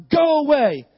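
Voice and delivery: loud, forceful order; commanding. Retold or imitated. Father and son banishing the crocodile, commanding. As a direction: order the intruder out.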